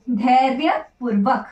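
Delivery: धैर्यपूर्वक spelled out slowly, syllable by syllable; teacher dictating धैर्यपूर्वक slowly; slow model word. Shown as a demonstration. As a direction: say धैर्यपूर्वक slowly for students to copy. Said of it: "धैर्यपूर्वक होता है".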